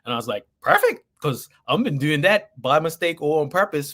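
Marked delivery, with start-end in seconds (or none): 0.6-1.0 very high delighted squeal; excited. Retold or imitated. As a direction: squeak it out with joy. His excited reaction retold.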